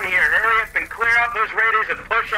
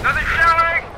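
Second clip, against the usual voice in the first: alarmed shout; 7 semitones higher, 3 dB louder than usual. Acted. Shelling warning.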